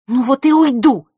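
loud, high retort; defiant shout. Acted. Scene: Vasilisa shouting back before leaving, loud. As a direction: fling it back.

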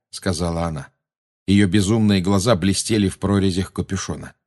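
plain reading voice

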